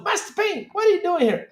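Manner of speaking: very high, squeaky mock voice; high mock voice greeting the master; movie character quote.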